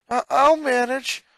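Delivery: mild shaky unease; uneasy reply. Acted. Boy answering his sister after a bad night, uneasy stammer. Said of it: "his uneasiness ever so clear".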